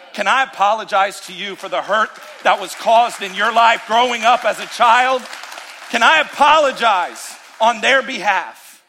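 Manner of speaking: rising preacher's plea of apology; preaching at full force. Acted. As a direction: press the apology on the crowd.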